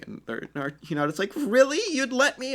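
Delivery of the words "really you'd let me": very high delighted squeak; thrilled Hinata. Acted. Hinata surprised Naruto lets her follow, very high delight.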